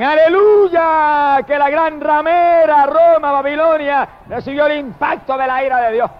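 booming celebratory shout, slowing on the name; heavenly shout. Acted. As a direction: proclaim it like the rejoicing crowd in heaven. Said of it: "dice que en el cielo gritan".

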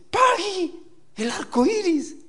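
put-on high-pitched small child's voice; little granddaughter. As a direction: call out like a little girl spotting something. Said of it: "Estaba con mi nietecita".

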